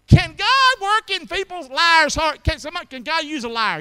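loud, high, slowed call to the room; rising, shouted preacher's question; preaching climax. Real, not acted. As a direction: preach it out at full force.

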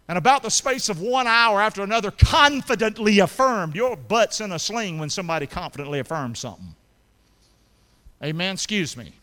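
loud, high revival preaching; preacher building to a shout; full-force preaching. Acted. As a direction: drive the story home to the congregation. Preaches the Peter story.

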